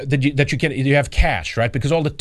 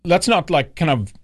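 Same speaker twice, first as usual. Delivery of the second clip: mock imitation; 3 semitones higher than usual. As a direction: play the bystander dodging, pitched up. Imitating man in the video.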